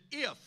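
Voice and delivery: God stressing If,; emphasis on if. Retold or imitated. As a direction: lean on If,. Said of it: "he put the emphasis".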